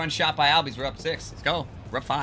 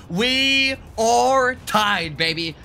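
excited; 9 semitones higher, 4 dB louder, much slower than usual. Real, not acted. Celebrating tying the game.

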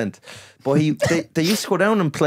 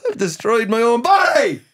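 higher, hammed-up lament; mock despair; 11 semitones higher, 5 dB louder than usual. Mocking the flat-earther's fear of vaccines, hammed-up lament. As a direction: wail it in fake ruin.

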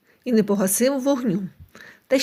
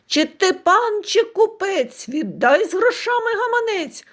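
rooster crying out; 8 semitones higher than usual. A narrator's reading. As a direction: shout it like a cocky rooster chasing a carriage. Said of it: "заходився кричати".

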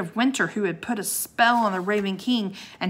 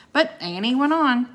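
ordinary delivery

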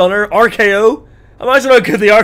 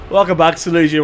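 usual speaking voice

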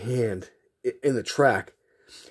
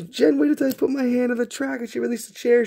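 put-on tattling kid; 11 semitones higher than usual. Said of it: "I'm like".

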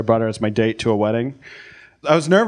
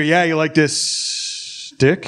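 unsure, slow, tentative dirty talk; a lightly voiced nervous lover trying dirty talk; hesitant dirty talk. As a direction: fumble the lines, asking permission. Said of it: "I had hesitation in my voice".

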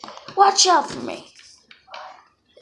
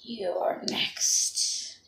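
low, creepy threatening whisper; menacing whisper; 9 semitones lower, 10 dB quieter than usual. Pretend. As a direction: breathe the threat softly. Monster threatens the victim.

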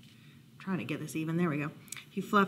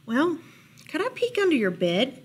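small, raised mouse voice; tentative mouse; 6 semitones higher than usual. A narrator's reading. Mouse asking to check under Bear's bed, tentative. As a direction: ask tentatively, a little high.

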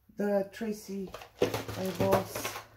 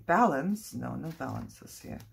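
usual speaking voice

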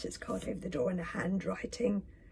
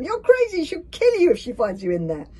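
high, loud alarmed squeal; panicky hiss; 10 semitones higher, 10 dB louder than usual. A narrator's reading. Fishlegs warning Hiccup off the witch's hut, panicky. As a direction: warn him in a squeaky panic. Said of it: "hissed Fishlegs".

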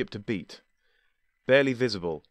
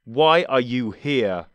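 blunt; 3 semitones higher, 7 dB louder, much slower than usual. Acted. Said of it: "he asked bluntly".

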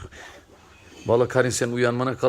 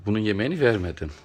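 usual speaking voice

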